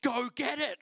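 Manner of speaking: excited yell to a friend on the highway; retold yell. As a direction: shout it at your mate to grab the cash. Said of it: "I yell at Joe".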